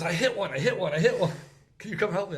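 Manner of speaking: high, loud, excited retold phone call. First-time hunter calling his friend for help, excited. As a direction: imitate a buddy thrilled at his hit.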